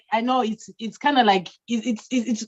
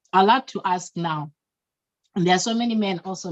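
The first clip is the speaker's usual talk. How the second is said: ordinary talk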